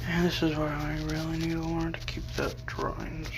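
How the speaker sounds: whispering under breath; whispered aside. Real, not acted. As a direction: whisper it to yourself. Aside while reading the story.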